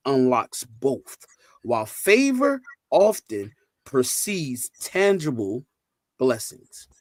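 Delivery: slow, emphatic reading with weighted key words; measured, deliberate delivery, phrase by phrase; slow read-aloud. Real, not acted. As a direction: read it out slowly so everyone can follow. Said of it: "I'm gonna read this slow so everybody can understand".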